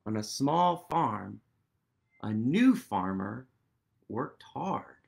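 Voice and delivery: measured storybook read-aloud; story narration. A narrator's reading. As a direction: read it like the page of a bedtime book. Reads the book's text aloud.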